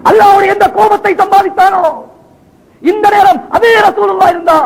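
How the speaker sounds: high, sustained sermon cry; full-force preaching. Acted. Preacher voicing Abu Bakr's dread of God's anger, full-force. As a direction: cry it out from the pulpit.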